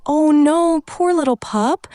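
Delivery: high, drawn-out pitying coo; dismayed sympathy. Acted. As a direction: feel sorry for a hurt puppy. Reacting to puppy being stepped on.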